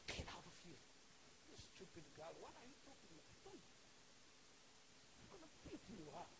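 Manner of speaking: low-volume acted threat; soft, irritated mock menace; hushed threat. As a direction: warn her off under your breath. Acts Peter threatening servant girl.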